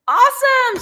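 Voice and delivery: high, bright burst of delight; thrilled one-word reply; excited. Retold or imitated. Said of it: "And I was like".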